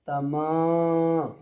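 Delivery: "तमा" with a long, sustained final vowel; madd held long. Shown as a demonstration. Teacher showing pupils the alif madd, held long. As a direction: draw out the मा in तमा for one full alif. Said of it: "खींचेंगे एक अलिफ के बराबर".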